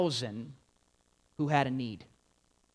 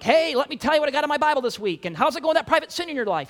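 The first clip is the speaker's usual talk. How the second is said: high, fast, loud eager busybody voice; loud mock zealot rattling on; 8 semitones higher, 6 dB louder, faster than usual. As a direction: barrel in like an overeager churchgoer. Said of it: "that guy who comes up to you and is like".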